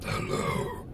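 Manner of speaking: hushed, voiceless hello; barely-there whispered greeting. Shown as a demonstration. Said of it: "all these different ways".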